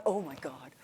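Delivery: low, soft oh my god; hushed dread. Retold or imitated. Peering over the ninety degree drop, hushed oh my God. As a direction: breathe it out in awe at the drop.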